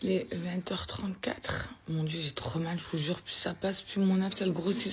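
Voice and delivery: soft night whisper. Real, not acted. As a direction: whisper so nobody wakes. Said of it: "whispering at night".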